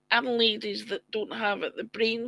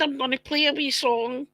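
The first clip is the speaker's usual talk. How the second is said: ordinary delivery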